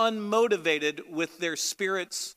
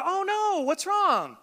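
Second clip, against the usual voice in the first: concerned friend; 10 semitones higher than usual. Retold or imitated. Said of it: "and they go".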